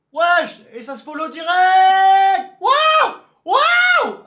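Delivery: crié très aigu et fort, étiré; cri de surprise. Real, not acted. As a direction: explose de surprise en criant. Surprise face à un raid. (cri de surprise, très aigu et fort)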